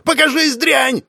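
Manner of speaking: high-pitched frantic yell; hysterical scream. A narrator's reading. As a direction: scream a desperate challenge into the dark. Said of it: "истерично заорал убийца". (истерично заорать)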